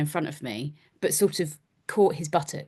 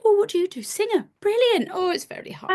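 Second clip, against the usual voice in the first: much higher, both parts performed; replaying the singer chat, each line much higher; classic exchange retold. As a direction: rattle through the quick exchange high and bright. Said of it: "So it's the classic".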